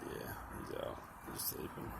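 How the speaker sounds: soft hushed whisper; whispering over a crib. Real, not acted. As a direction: whisper so he won't wake. Pet falling asleep, keeping quiet.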